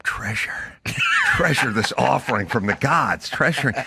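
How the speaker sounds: low, reverent half-whisper; hushed awe. Acted. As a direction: breathe it softly, like beholding sacred treasure. Tells a find with hushed wonder.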